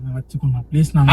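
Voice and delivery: very soft, low plea; small, quiet entreaty; hushed pleading. Acted. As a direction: beg almost under the breath. Begging him to accept the item.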